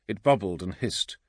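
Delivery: ordinary delivery, nothing marked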